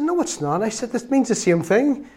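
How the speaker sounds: lightly acted young man answering back; retold protest.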